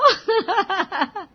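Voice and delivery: high burst of giggling; acted laugh. A narrator's reading. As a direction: crack up at your own joke. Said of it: "हंसते हंसते". (खिलखिलाकर हँसना)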